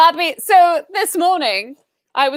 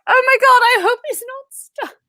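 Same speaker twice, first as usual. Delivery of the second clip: mock worry; 7 semitones higher than usual. Retelling seeing the husky's head under the fence, mock alarm. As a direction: act out the alarmed walker. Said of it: "I was like".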